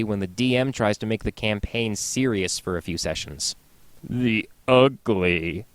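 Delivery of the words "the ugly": slow dramatic. Acted. Slow dramatic segment header.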